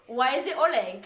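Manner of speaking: sudden loud mock-British accent; retold outburst in a put-on British accent. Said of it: "In a British accent, just out of nowhere".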